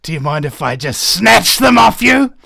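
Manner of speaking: sinister villain bursting into a shout; creepy request exploding into a loud snatch. Acted. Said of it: "in a sinister voice".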